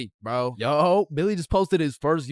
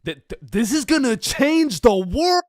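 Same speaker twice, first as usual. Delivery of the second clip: higher, stammering with awe; amazed; 6 semitones higher than usual. Acted. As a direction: gasp it out, stunned by the news. Amazed listener reacting.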